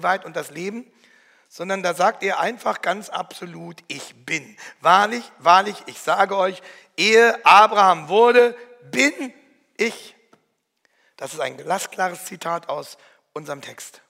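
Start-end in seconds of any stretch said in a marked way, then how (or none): 3.9-10.2 loud, slow, ringing declaration; solemn proclamation. Acted. Preacher voicing Jesus before his opponents, loud solemn proclamation. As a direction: proclaim it like scripture thundering.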